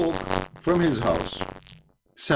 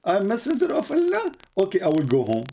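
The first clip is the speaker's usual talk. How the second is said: high, squeaky mock disbelief, much higher; mock surprised prophet. Teller mocking the prophet, squeaky high disbelief. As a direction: play the prophet as a clueless squeaky fool. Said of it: "he say".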